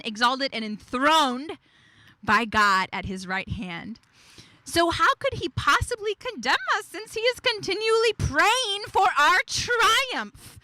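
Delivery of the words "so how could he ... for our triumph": excited rise. Real, not acted. Rising sermon climax.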